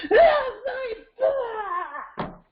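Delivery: high, drawn-out wailing outburst; mock tantrum. Child attacking the parent who tried empathy, high wailing mock tantrum. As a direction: shriek it slowly like an inconsolable child. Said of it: "I get attacked".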